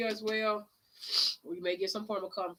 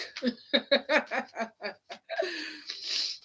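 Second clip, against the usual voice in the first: high, loud burst of laughter; real, high laughing fit; 6 semitones higher, 3 dB louder than usual. Real, not acted. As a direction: cackle at your own joke. Laughing at her own joke.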